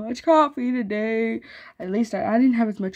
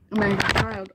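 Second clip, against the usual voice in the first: ordinary delivery, nothing marked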